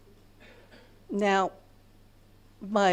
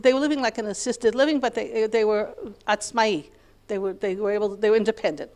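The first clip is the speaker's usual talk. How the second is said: normal talking voice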